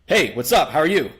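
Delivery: steady from above, not fake masculine; breaking rapport. Shown as a demonstration. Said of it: "And now you're like".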